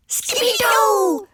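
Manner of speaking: high, long hooted cheer; hooting. A narrator's reading. Little dogs discover the kitten in bed, high drawn-out hoot. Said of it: "hooted the Puchitos".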